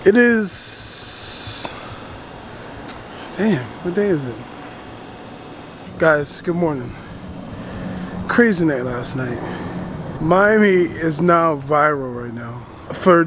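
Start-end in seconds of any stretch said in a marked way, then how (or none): none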